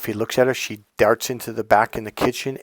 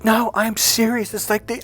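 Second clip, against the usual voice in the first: imitating earnest ex-girlfriend; 11 semitones higher than usual. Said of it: "she goes".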